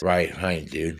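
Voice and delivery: words smeared together, consonants dropped; lightly slurred prisoner voice; imitating slurred speech. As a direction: mimic a stroke-slurred mumble of 'Right, right, dude'. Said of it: "in his slurred speech".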